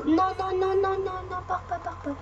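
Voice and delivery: non, non, non aigus et pressants, en suppliant; supplication paniquée. Real, not acted. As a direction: implorer qu'il ne parte pas, voix montée. Personnage qui s'en va. (suppliant aigu : « non, pars pas »)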